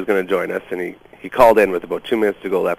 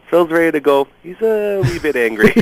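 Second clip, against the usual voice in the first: gingerly warning; 9 semitones higher than usual. Retold or imitated. Producer in host's ear warns of irate guest, sheepish high warning. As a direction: pitch it up as a sheepish heads-up.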